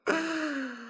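high falling theatrical sigh; exasperated sigh. Acted. Fairy reluctantly giving in to the king, high sigh. Fairy gives in after mocking.

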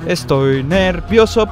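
ordinary delivery

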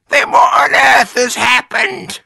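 very high, loud distressed wife; screechy high-pitched cry; Mrs Twit crying out. A narrator's reading. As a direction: shriek it in bewildered panic. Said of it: "cried Missus Twit".

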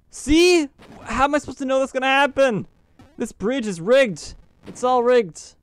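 high, fast exasperated protest; outraged, it's not fair; indignant. Real, not acted. Complaining about trap bridge.

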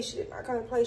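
soft faded repeat; quiet echo. Real, not acted. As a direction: let the echo fade out. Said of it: "repeated fading".

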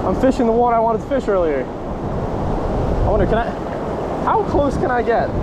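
ordinary talk, nothing marked

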